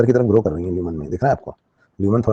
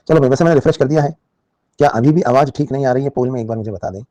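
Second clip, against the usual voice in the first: loud check-in; 4 semitones higher, 5 dB louder than usual. Real, not acted. Checks audio with live students.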